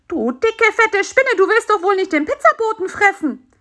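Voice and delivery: high-pitched, indignant panic; startled little character protesting shrilly; alarmed cry. A narrator's reading. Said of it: "rief er erschrocken".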